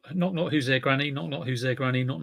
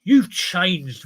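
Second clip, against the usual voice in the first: higher, louder reproachful moan; a touch of wounded accusation; old cinema whining; 4 semitones higher, 7 dB louder than usual. Retold or imitated. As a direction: sulk like a neglected old building. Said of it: "This poor old cinema sitting there going".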